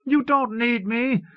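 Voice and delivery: high, slow insisting; insistent. Acted. Panicky brother insisting to Paul, high and slow. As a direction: press the point, drawn out. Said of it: "Severin insisted".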